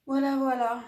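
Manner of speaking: « Voilà, voilà » lancé plus fort et plus haut; voilà enjoué. Real, not acted. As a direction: reprendre « voilà » avec entrain, plus fort. Conclusion répétée, voilà. (voilà joyeux, plus fort)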